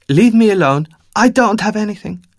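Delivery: put-on high, frightened woman with an Eastern European accent; scared old woman pushing him off, Eastern European accent. Homeless woman brushing off a stranger, Eastern European accent. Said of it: "thick Eastern European accent in her voice".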